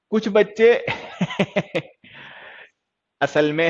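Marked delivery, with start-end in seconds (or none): 0.1-1.9 higher voice dissolving into giggles; real helpless chuckle mid-sentence; breaking into laughter. Real, not acted. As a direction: start talking, then crack up. Cracks up at students' answers.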